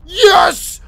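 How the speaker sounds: high, loud cry of victory; elated gamer shout; triumphant yell. Real, not acted. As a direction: shout it as the enemy falls. Wins fight.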